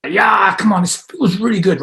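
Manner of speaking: rising self-empowerment; self-empowered excitement. Shown as a demonstration. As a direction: cheer yourself on. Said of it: "there's more emotion".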